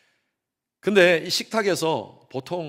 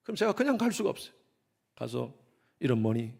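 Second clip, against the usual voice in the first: usual speaking voice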